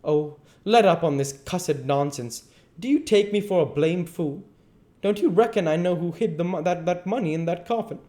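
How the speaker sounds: high, loud, exasperated challenge; bristling anger. A narrator's reading. Duke refusing to be taken for a fool, bristling. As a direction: snap back in indignation. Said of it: "The Duke bristles up now".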